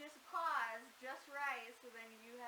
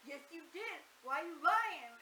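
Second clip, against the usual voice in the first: imitated caller, pushing louder at the end; mock accusation; 6 semitones higher, 12 dB louder than usual. Retelling the prank caller challenging dad, mock accusing. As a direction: badger him, raised pitch, building in volume.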